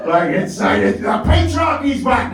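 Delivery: high-pitched celebratory shout; mock triumph. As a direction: crow in hammed-up victory. Mock celebration of regained power.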